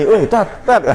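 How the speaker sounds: laughing shout to a friend; calling out. Retold or imitated. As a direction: hail a friend through laughter. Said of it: "manggil-manggil Yani".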